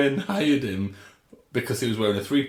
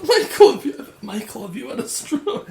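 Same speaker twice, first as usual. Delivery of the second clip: high and laughing; 14 semitones higher, 6 dB louder than usual. Real, not acted. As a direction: blurt it out while cracking up. Teasing partner mid-laughter.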